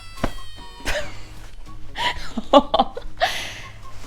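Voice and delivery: airy laugh trailing away. Real, not acted. Laughs after her greeting.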